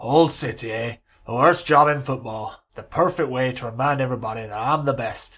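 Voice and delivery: put-on loud, firm Scottish-Irish hybrid accent; a mock Sir Alex soothing his assistant in a hybrid accent. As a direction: settle him down in a booming Scottish-Irish accent. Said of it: "Sir Alex has got an Irish accent".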